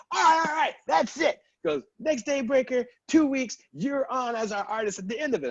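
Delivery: high, loud hyped yell; excited shout. Retold or imitated. Producer books him as the artist, shouting. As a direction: bellow the offer like great news. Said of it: "they go".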